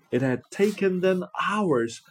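ordinary delivery, nothing marked

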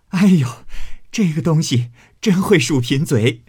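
rocking with laughter at her wit; laughing hard. Acted. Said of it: "笑得前仰后合".

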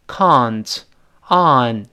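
British "can't" with a long ah vowel; British pronunciation. Shown as a demonstration. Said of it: "英联邦读成". (英联邦口音，can't读“啊”音)

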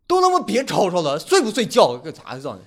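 a hint of a gruff Northeastern big brother telling everyone off; Northeastern accent shout. Northeastern passenger scolds late-night card players in a Dongbei accent. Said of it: "口音怎么有点杂种的感觉".

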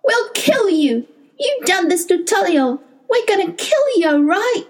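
put-on a small boy screaming his threat through tears; high-pitched furious little boy; little boy crying out. A narrator's reading. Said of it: "his little boy's voice cried".

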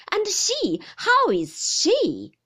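loud, high, drawn-out sharp demand. A narrator's reading. Aunt pressing the visitor for news of she, sharp. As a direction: demand it sharply, stretching the last words. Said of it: "said my aunt sharply".

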